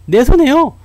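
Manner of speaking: high, loud exclaim of my loss; emphatic punchline. Acted. Speaker answering his own question to the audience, loud and high. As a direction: shout the punchline for laughs.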